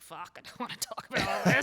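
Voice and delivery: loud, laughing mock panic; laughing dread. Facing an audience of dad's age, laughing mock dread. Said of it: "you're like".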